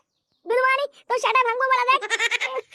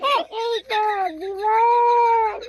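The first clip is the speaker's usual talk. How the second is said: put-on very slow, sobbing drunken drawl; drunk weepy drawl; much slower than usual. A drunk son sighing over his life, slow and weepy. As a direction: stretch it out like a maudlin drunk.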